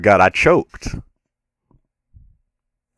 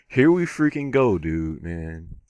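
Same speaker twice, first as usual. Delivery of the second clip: ordinary talk, nothing marked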